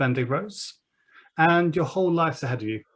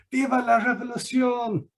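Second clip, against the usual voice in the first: distant rebel cry; 9 semitones higher than usual. Acted. GM voicing distant revolutionary cry.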